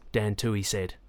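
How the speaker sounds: plain reading voice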